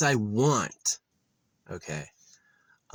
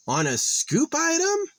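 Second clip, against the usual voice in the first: much higher, louder incredulous cry; 4 dB louder than usual. Real, not acted. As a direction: pitch up in sheer disbelief. Outraged at nonsensical sale sign.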